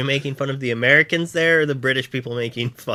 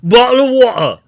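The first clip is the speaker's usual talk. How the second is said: high loud repetitive Cockney; British accent; 7 semitones higher, 8 dB louder than usual. Acted. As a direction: loop it like a game NPC. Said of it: "keep saying".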